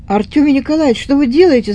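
high, loud alarmed shout; alarmed cry. A narrator's reading. Maid Tanya cries out, seeing the boy poison himself. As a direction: call out in fright at what he's doing. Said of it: "закричала Таня".

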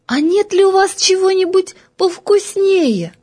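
put-on high, small coaxing girl's voice; little girl voice. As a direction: ask shyly like a small girl. Голос Феньки. (высокий робкий детский голосок)

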